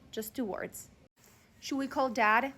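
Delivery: ordinary talk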